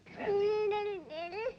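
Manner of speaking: very high infant gurgle; high-pitched baby babble; baby squeal. Acted. Baby in back seat answers.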